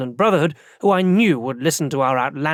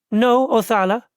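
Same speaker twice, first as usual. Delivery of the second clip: put-on landlady with a thick Barajese accent, pitched higher; strong Barajese accent; 7 semitones higher than usual. A narrator's reading. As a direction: answer the stranger in a heavy Barajese accent. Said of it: "she said in a strong Barajese accent".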